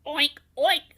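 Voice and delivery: cheerful pig grunts; the piglet joins in; piglet oinks. A narrator's reading. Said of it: "said the piglet".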